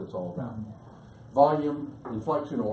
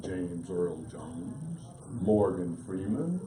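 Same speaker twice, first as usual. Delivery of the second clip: slow, low, resonant voice; deep vocal pitch; 6 semitones lower, 4 dB quieter, much slower than usual. Shown as a demonstration. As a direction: drop down deep and draw the names out. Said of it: "deeper vocal pitch".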